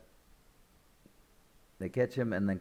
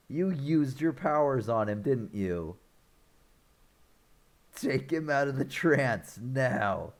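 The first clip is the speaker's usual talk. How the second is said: stern accusation; 4 semitones higher than usual. Retold or imitated. Retold accusation and order to undo trance.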